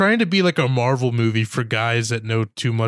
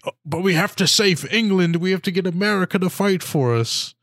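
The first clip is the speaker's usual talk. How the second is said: higher, hammed-up earnest plea; mock pleading; 6 semitones higher than usual. Host parodying the British wanting American help, mock pleading. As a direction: imitate a movie hero urging a noble cause.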